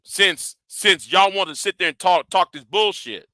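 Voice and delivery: normal talking voice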